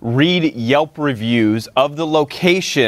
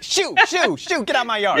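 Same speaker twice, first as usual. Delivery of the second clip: shooing a cat; 11 semitones higher than usual. Pretend. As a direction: shoo the cat away in a high voice.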